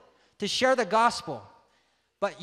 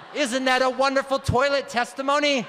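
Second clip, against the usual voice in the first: normal talking voice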